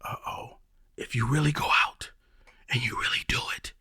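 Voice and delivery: whispered inner doubt, sneaky and soft. Retold or imitated. The mind whispering doubts before a first step, hushed. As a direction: whisper it like a voice of doubt in your head. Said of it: "your mind is gonna tell you".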